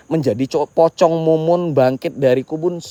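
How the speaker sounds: usual speaking voice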